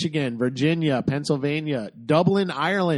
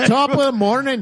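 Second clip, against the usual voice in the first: laughing fake Irish lilt, much higher and louder; stupid Irish accent; 10 semitones higher, 7 dB louder than usual. Host laughing at his own Irish bit, exaggerated Irish accent. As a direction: ham up an Irish hello through a laugh. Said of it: "my stupid accent".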